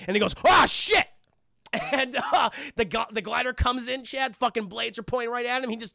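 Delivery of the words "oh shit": high, loud mock shock; startled yelp. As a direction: yelp at sudden danger. Goblin startled by glider.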